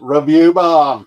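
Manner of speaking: loud, drawn-out booming echo; hammed-up stretched shout; mock announcement. Booming echo of co-host's phrase.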